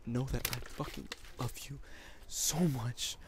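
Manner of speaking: choked, breathy whisper through tears; tearful whisper. Real, not acted. Boyfriend crying to his girlfriend on YouTube, a broken whisper. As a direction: force the words out past crying, barely voiced. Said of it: "You heard the tears, you heard the pain in my voice".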